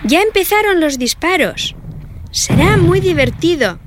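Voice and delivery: put-on high, childlike urging; eager little girls. A narrator's reading. Girls urging mother to come watch, eager. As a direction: sound like excited kids hurrying mom.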